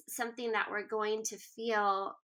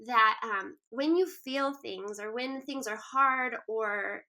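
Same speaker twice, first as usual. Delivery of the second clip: ordinary talk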